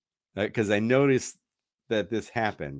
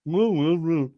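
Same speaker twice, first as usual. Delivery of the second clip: higher, wordless oafish mooing; dumb husband voice; 7 semitones higher, 3 dB louder, slower than usual. Retold or imitated. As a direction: hoot like a dopey boyfriend. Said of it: "dumb voice, right?".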